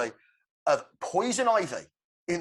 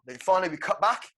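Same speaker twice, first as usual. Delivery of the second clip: ordinary talk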